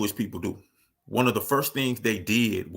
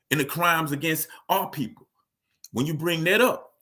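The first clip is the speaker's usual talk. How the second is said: ordinary talk, nothing marked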